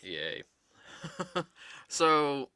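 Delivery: ordinary talk, nothing marked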